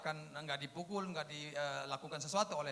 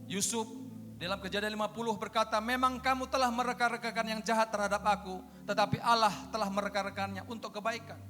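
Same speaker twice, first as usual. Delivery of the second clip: raised declamatory voice building to a loud command; forceful preaching; 4 semitones higher, 6 dB louder than usual. Real, not acted. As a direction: drive the exhortation home to the congregation.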